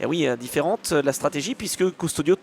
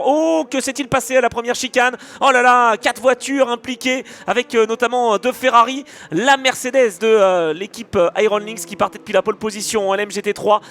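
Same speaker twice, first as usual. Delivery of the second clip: higher, louder excited crash call; excited motorsport commentator; 5 semitones higher, 4 dB louder than usual. Real, not acted. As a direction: call the pile-up with rising alarm. Live crash call, several cars.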